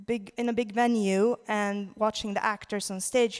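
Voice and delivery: usual speaking voice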